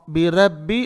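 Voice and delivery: बिरब्बी with a light, flattened ra; thin out the ra in बिरब्बी; wrong thin ra. Shown as a demonstration. Teacher shows a learner's mistake, thin ra. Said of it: "रॉन्ग".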